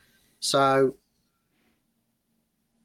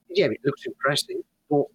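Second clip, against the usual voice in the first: ordinary delivery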